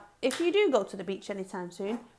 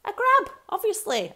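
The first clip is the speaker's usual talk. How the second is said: playful crab pose, higher; mock crab; 10 semitones higher, 6 dB louder than usual. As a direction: pretend to be a crab for the kids. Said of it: "I was being a crab".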